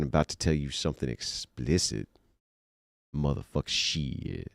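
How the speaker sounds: low, soft late-night DJ croon; sexy radio voice. Acted. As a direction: purr it quietly, with long pauses. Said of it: "I'll use my sexy TikTok radio voice".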